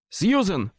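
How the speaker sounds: loud call down the stairs. A narrator's reading. Narrator calls to Susan downstairs, loud shout. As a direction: shout her name again, straining. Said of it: "вигукнув я".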